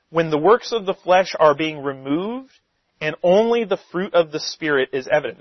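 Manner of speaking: ordinary talk, nothing marked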